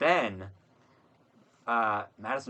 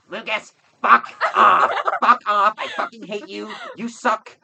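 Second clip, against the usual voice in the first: loud, much higher mock rage, slower at first; hammed-up furious yell; 9 semitones higher, 10 dB louder than usual. Mocking the roommate's hostility, exaggerated furious yelling. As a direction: overplay the hostile roommate screaming insults. Over-the-top hostile impression.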